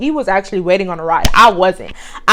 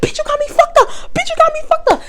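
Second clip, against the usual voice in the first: very high imitated insult; hammed-up angry coworker; mock snarl; faster than usual. Coworker insults her, retold.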